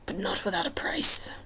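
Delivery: amused, lazy menace, half whispered; lightly voiced Hades.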